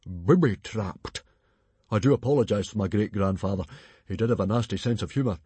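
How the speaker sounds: big bluff chieftain; booming chief. Acted. Stoic quizzing Alvin, loud and hearty.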